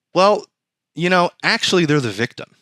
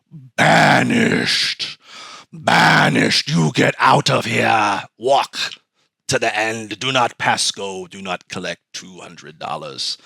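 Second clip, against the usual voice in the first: booming God voice; 4 semitones lower, much slower than usual. Retold or imitated. God banishing him from garden.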